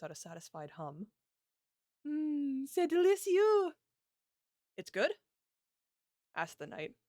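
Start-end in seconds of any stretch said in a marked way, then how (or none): 2.0-3.7 delighted. Acted. Princess tastes the cake. 4.8-5.2 surprised. Acted. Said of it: "a hint of surprise coming through her voice".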